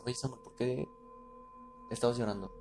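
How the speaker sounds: voz suave de mamá que pregunta; madre en voz baja. Retold or imitated. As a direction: pregunta bajito, como al despertar a un hijo. (mamá preguntando quedito)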